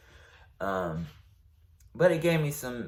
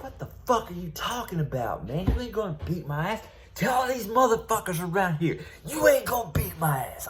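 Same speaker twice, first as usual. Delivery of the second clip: higher, rising to a shout; retold angry threat; 10 semitones higher than usual. I was like.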